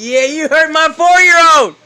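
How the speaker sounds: loud, high proud shout; proud hype. Real, not acted.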